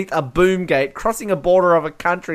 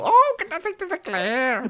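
high-pitched mock feminine voice; Nikki Van Der Zyl; 9 semitones higher, 4 dB quieter than usual. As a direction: put on a high film-heroine voice. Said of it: "Nikki Van Der Zyl".

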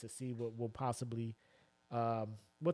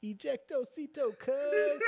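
slow, high catchphrase building louder; movie quote; 12 semitones higher, 5 dB louder, much slower than usual. Acted. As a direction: stretch out the film line, pitched way up. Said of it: "most said quotes from movies".

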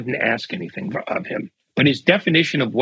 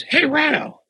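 much higher-pitched mock holler; retold yell, escalated; 14 semitones higher than usual. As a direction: imitate a second, more insistent call for attention. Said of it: "he yells".

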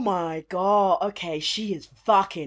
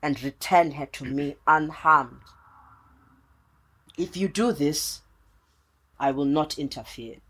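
commanding spirit voice; 7 semitones lower than usual. Acted. Shaka's spirit bargains with player.

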